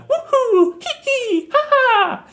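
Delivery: very high, loud cartoon hero whoops; high-pitched, gleeful catchphrase impression; Mario. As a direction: imitate a video game hero's squeaky cheers. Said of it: "famous lines that Mario does".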